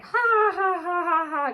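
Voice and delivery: Grandpa's hammed-up too-hard laugh; forced laugh. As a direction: overdo an awkward nervous laugh. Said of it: "Grandpa laughed a little too hard".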